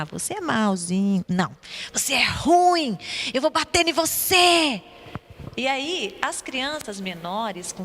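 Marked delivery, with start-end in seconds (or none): 1.9-4.8 slow, higher, louder, breathy menacing threat. Shown as a demonstration. Teacher demonstrating the emotional way to insult the rice, menacing. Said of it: "Tinha que trazer emoção pra falar".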